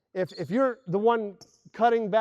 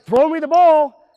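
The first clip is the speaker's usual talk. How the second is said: loud, slow, drawn-out call; receiver calling for ball; 8 semitones higher, 13 dB louder, slower than usual. Retold or imitated. Wide receiver yelling for the ball, loud and slow. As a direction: demand the ball from far off. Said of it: "it's like".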